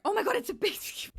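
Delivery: high, loud sudden gasp of realisation; shocked realisation. Real, not acted. Builder realising she broke her own rule, loud shocked. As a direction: catch yourself out mid-sentence. Spots a banned base-game mirror.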